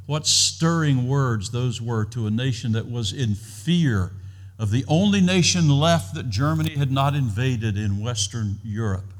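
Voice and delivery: normal talking voice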